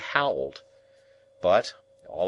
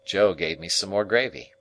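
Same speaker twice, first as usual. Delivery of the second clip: plain reading voice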